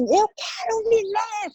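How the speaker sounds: drawn-out, high-pitched cry of resolve; retold desperate cry. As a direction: act out crying out for life, slow and high. Retold cry of will to live. (grito de vontade de viver encenado, agudo e prolongado)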